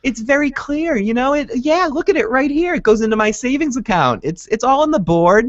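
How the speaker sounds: put-on high, sweet little old lady voice; high sugary granny voice, all innocence; innocent old lady. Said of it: "such an innocent, nice lady ... She's like".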